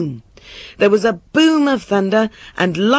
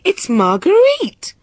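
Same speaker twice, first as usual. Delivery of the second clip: slow, very high exclamation; shocked king, voice soaring; astonished cry; 12 semitones higher, 3 dB louder than usual. A narrator's reading. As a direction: announce her in stunned disbelief. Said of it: "cried the king".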